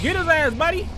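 high, loud egging on; excited cheer. Real, not acted. Urging his dog on against the enemy, a high excited cheer. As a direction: hype up the dog to attack.

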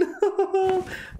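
bright burst of giggles; little giggle at the new dragon; high giggle. Real, not acted.

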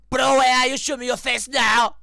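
high, loud challenging yell; calling out the hidden enemy; demanding shout. Real, not acted. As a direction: bellow the challenge.

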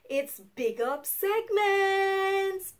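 slow, high, loud segment call; stretched-out hype intro; hyped announcement. Acted. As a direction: announce it like a show jingle. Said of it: "You know what time it is?".

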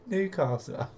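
second, high mocking "Newcastle." after a laugh; mocking disbelief. Real, not acted. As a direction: sneer "Newcastle." as if the offer is a joke.

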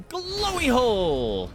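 high, loud, stretched-out call; sudden cry. Real, not acted. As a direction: draw it out high, sliding down. Calling out move in game.

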